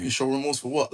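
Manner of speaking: retold incredulous retort. Defendant asked to show remorse, incredulous retort. Retold protest to probation officer.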